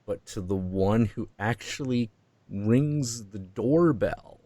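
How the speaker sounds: normal talking voice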